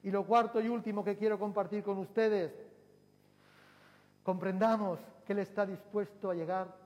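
fervent pulpit exhortation; rising preaching. Acted. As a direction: build the call to the congregation. Preacher builds to full force.

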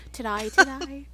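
"Today" said as "to-die", Australian vowel; mock Australian "to-die" for today; playful Australian "Today, today" said as "to-die"; Australian accent. Shown as a demonstration. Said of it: "Unless I do today".

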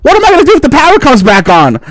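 high, fast fretting; anxious daughter. Retold or imitated. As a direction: rattle off the worry. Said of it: "She's like".

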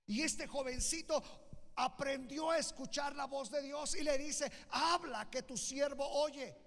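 high, loud preacher's proclamation; full-force preaching. Real, not acted. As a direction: preach it out to the whole congregation at full voice.

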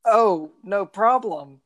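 put-on breezy girl reply, drawn out; man doing a woman's voice. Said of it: "now I have to do another girl's voice".

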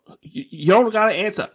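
high, loud and hurried; urgent. Acted. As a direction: rush to stop him, voice up high. Urgently calling off question.

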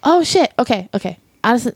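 ordinary talk

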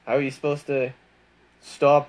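ordinary talk

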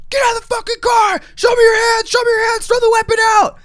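loud, high, urgent yelled commands; shouting cops. Retold or imitated. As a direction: bellow orders as police at gunpoint. Retold police closing in, yelling.

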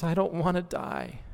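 put-on high, tearful child's wail, lightly done; mock crying toddler. Father voicing his crying three-year-old, tearful and high. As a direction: imitate a little girl crying. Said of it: "starts crying and says".

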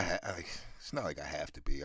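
normal talking voice

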